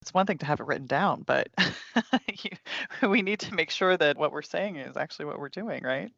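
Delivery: normal talking voice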